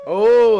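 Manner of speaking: loud howling wolf impression. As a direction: throw your head back and howl. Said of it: "that's pretty good".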